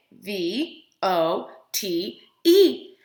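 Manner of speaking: each letter slow and separate; spelled out slowly. Shown as a demonstration. Teacher spelling the word slowly for the children.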